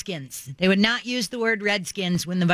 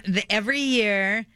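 ordinary talk, nothing marked

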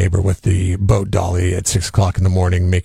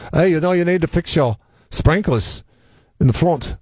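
a hint of an Irish lilt, higher; light Irish accent; 8 semitones higher than usual. Host imitating his Irish sprinkler guy, light Irish accent. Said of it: "he's Irish, so he says it like".

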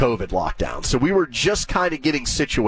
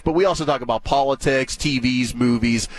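normal talking voice

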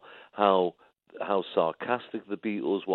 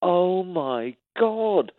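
high, very drawn-out mock dismay; slow, high imitation of an aghast wife; appalled wife; 10 semitones higher, much slower than usual. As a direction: stretch each word in imitated horror. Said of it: "my wife's standing there going".

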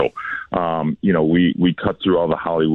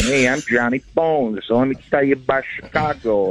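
Chicago accent; 9 semitones higher than usual. Shown as a demonstration. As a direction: ham up a Chicago tour guide accent. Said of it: "do the whole Chicago accent thing".

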